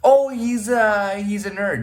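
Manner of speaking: high mock guess, slow start; mimicked snap judgement. People wrongly labelling him a nerd, mock guessing. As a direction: stretch out the opening, pitched well up, mimicking.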